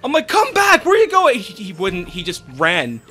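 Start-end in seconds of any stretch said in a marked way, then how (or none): 0.3-1.4 high, loud call; urgent retold shout; yelling after someone. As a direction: holler it at a fleeing friend. Calling after friend running away.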